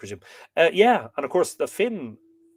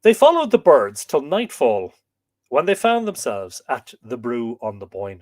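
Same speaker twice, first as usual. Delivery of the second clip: story narration; 10 dB louder than usual. A narrator's reading. Storyteller reading an Irish myth to viewers, emphatic narration.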